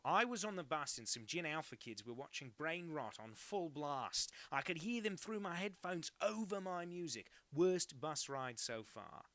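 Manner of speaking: put-on loud hammy Australian accent. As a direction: overdo a bad Aussie accent. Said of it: "imitating an Australian accent so badly".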